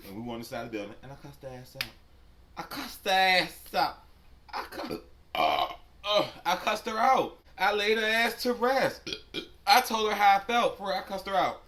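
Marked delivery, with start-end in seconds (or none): 2.6-11.6 much higher, louder boast; reliving the fight, much louder; heated shout. Real, not acted. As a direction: yell it out, all fired up. Boasting about cussing her out.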